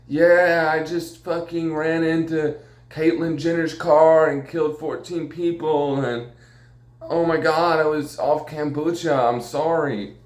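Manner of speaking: high, drawn-out mock celebrity confession; accidental Canadian-tinged southern drawl; Lindsay Lohan. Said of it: "I'm not gonna be like Lindsay Lohan".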